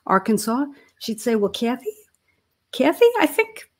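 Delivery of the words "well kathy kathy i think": light Southern mom impression; imitating a Southern mother, high; Southern accent. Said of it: "she'd say in her Southern accent".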